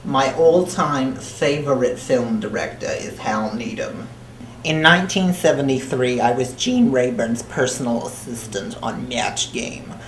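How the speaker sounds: thick put-on New Jersey accent, held all the way. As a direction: talk like a crude New Jersey broad throughout. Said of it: "I don't know where the accent came from".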